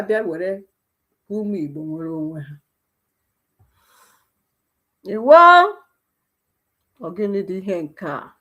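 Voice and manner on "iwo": a sharp, very loud, high cry; angry outcry. Real, not acted. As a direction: explode in anger. Angry exclamation.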